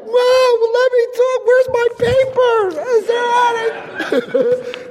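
high, loud mock-panicked pleading; panicked high squeal. Man being hit by the sister, squealing high to her mom. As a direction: squeal like a cornered man under attack.

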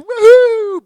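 high loud woohoo; party whoop. Retold or imitated. Partying in bar.

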